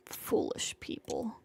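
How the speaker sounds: breathy low whisper of scorn; a cold whispered sneer at the passersby; contemptuous whisper. Acted. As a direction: sneer it under your breath. Killer's inner contempt in dream.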